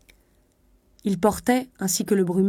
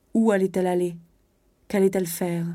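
ordinary delivery

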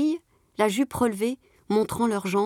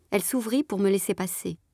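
usual speaking voice

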